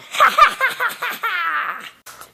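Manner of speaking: long hammed-up cackle; villain laugh. Clone laughs after revealing itself, villainous cackle. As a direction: cackle in a falling run of ha-has.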